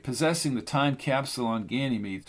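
ordinary delivery, nothing marked

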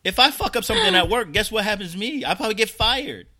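high, loud and laughing through it; riled up and laughing at it; laughing rant. Real, not acted. Storyteller venting about work to cohost, high and laughing. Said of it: "heated rant".

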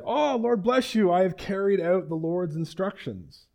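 high, gushing mock piety; over-pious greeting. Saul greeting Samuel after disobeying, high and piously gushing.

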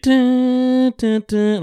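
humming a beat. Retold or imitated.

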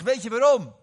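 high, very loud retort; shouted question. Retold or imitated. Preacher retells challenging his angry friend, shouted question. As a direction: fire the question back at full volume.

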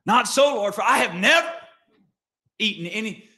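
high, loud indignant refusal; loud protesting Peter. Acted. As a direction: object hotly to God. Peter refusing, second reading.

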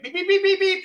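high, loud imitated beeps of a backup alarm; mock car beeping. Host mimics the car's warning alarm with high, loud beeps. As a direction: chirp it out like a parking sensor.

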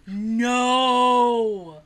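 long drawn-out "No"; disbelieving no. Retold or imitated. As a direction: stretch it out in mock disbelief. Disbelief at sister's reveal.

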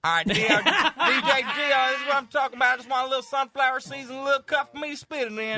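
hammed-up high, loud country accent; thick country accent. Host sets up DJ to shoot back, thick country accent. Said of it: "I'm gonna do a thick country accent to you".

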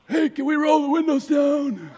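high, loud, shouted plea; desperate plea. Retold or imitated. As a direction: beg to air out the car, hammed-up. Guest's smell in the car.

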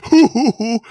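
loud, high mock hooting laugh; exaggerated high "hoo" laughter; imitated laugh. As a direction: hoot like a woman cracking up. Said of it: "she's laughing".

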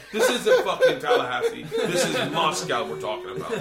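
high, loud comic incredulity, slowing at the end; exasperated outburst. Retold or imitated. Igor warning his friend over drinks, exasperated high outburst.

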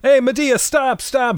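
high-pitched frantic call; mock alarmed holler; acted calling out. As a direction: call after someone to stop them, hammed-up. Acts calling out to stop van.

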